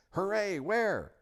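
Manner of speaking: put-on high, loud boyish cheer; excited. A narrator's reading. Edmund jumps up to look for Aslan, excited.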